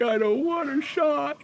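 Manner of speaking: put-on very high, childish whine; whiny refusal. Patient begs the dentist for no shot, whining high. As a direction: whimper it like a scared kid.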